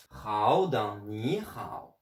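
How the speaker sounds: drawn-out Mandarin Hao with a falling-rising tone; Chinese demo word. Shown as a demonstration. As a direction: say Hao slowly, third tone dipping. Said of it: "je vous donne deux, trois petits exemples".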